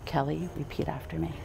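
hushed aside whisper. Real, not acted. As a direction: whisper the prompt quietly. Officiant prompting the bride aside.

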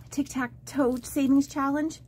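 normal talking voice

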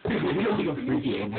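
words broken by laughter; can barely talk for laughing. Real, not acted. Laughing at friend's face.

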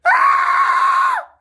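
sudden high-pitched shriek of fright; shrill scream. Acted. Startled dad sees the drain, screaming high. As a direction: scream like the drain just jumped at you.

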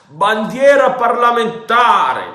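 loud, high, slow call of truce; shouted. A narrator's reading. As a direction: yell the word across the yard. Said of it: "gridò Silver".

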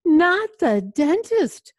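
high, loud, drawn-out protest; shriek. A narrator's reading. Vampire dreading the dentist, high storybook shriek. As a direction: shriek it slowly in storybook alarm. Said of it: "Louis shrieked".

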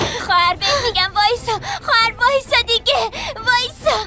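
tearful, begging her to stop; pleading through tears; crying plea. Acted.

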